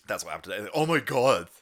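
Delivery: ordinary talk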